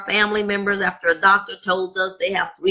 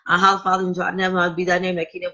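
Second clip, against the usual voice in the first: low, hushed recited prayer; murmured Lord's Prayer; 4 semitones lower, much faster than usual. Acted. Closing prayer.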